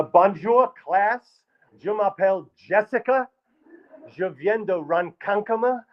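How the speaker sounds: put-on high, slow French with a Long Island accent; a Long Island teacher reciting French very slowly; Long Island accent mangling French. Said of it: "Madame Lefkowitz".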